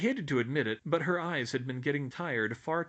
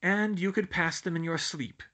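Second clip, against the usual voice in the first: retort; 6 semitones higher, 4 dB louder than usual. A narrator's reading. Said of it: "the blonde shot back".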